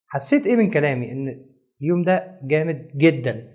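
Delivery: usual speaking voice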